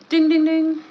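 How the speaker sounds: bright, loud bell chime; game show ding. Acted.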